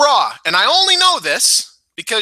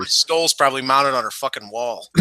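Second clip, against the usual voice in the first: normal talking voice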